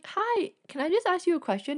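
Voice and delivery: small nervous voice, lifted high; shy high voice. Retold or imitated. Fan nervously approaching the artist, in a shy high voice.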